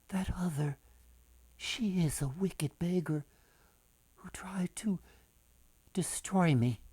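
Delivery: soft, secret whisper. A narrator's reading. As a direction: hush it like a secret to the king. Girl tells the king secretly.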